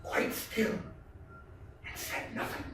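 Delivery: whispered, barely breathing; soft unvoiced hush. A narrator's reading. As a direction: whisper as if hiding in the dark. Narrator hushes while hiding.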